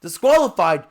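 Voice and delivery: high, loud, slow indignant cry; outraged disbelief. Acted. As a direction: protest the verdict in disbelief. Contestant outraged at verdict.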